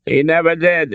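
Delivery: exasperated. A narrator's reading. Father complains about his son, exasperated. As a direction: let long-standing exasperation burst out. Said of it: "uttered in an exasperated tone".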